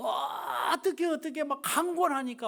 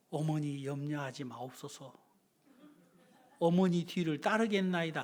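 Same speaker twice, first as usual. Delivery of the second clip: mock pious reply; 8 semitones lower than usual. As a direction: play the ideal dutiful son ironically. Mock pious obedient son. (낮고 조용한 장난스러운 경건체)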